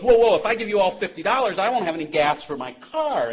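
put-on a drunk scrambling to back out of the deal; protesting drunk. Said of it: "the drunk trying to back out of the deal goes".

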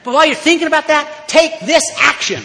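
rising to a loud preaching pitch; fiery preaching. Acted. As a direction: hammer it home from the pulpit. Preaching the old-timers' message.

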